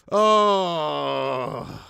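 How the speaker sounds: loud, long falling "oh"; exasperated groan. Real, not acted. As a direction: let out a big drawn-out groan. Groan closing the rant.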